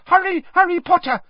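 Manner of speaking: loud, very high elf squeal; frantic high-pitched elf; Dobby. A narrator's reading. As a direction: squeak urgently to hurry them. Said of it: "squeaked Dobby".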